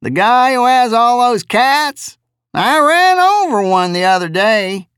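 high, drawling simple-minded voice; drawly jejune voice. Acted. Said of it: "the driver said".

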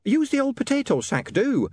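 high, fussy flustered landlady; flustered shrill landlady. Acted. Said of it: "now quite flustered by events".

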